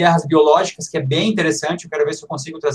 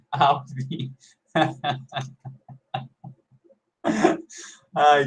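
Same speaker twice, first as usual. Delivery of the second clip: laughing; much slower than usual. Real, not acted. Laughing at glitchy audio.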